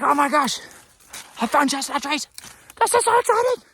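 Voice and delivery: high, loud gushing squeal; giddy excitement. Retold or imitated. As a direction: relive the thrill of finding chestnut trees. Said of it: "I was getting so excited".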